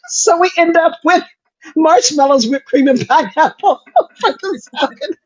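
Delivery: high-pitched, breaking up with laughter; words squeezed out through real giggles; laughing through it. Real, not acted. Host telling what is left of the family salad, laughing as she talks.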